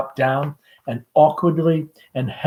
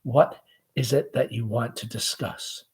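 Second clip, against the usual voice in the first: much softer and lower, tender; gently; 3 semitones lower, 6 dB quieter than usual. Acted. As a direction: ask her gently, quietly. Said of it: "Tom, gently".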